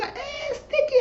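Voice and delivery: squealed, very high mimicked call; put-on high voice; high-pitched quote. Acted quote in story retelling.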